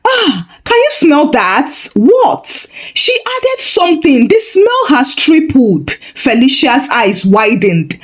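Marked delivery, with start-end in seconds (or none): 0.1-5.9 high, amazed gasp of a nosy neighbour; gasping amazement. A narrator's reading. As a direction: catch the scent and gasp in wonder. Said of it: "Mama Ifeanyi gasped".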